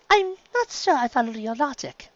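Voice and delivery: polite but pointed; restrained Rarity. Acted. Rarity restraining herself before Stephen. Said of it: "Rarity said instead".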